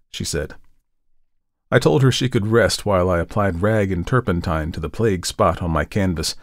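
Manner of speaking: ordinary delivery, nothing marked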